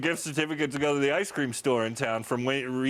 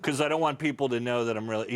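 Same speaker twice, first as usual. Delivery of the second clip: normal talking voice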